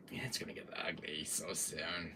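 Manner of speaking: soft, low whispered foreboding; spooky hushed tease; ominous whisper. Acted. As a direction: whisper it like a warning. Ominous aside.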